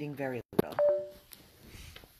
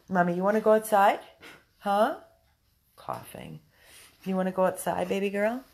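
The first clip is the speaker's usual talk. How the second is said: pet-talk voice; 5 semitones higher, 9 dB louder than usual. Real, not acted. Owner coaxing her sick dog outside, high loud baby talk.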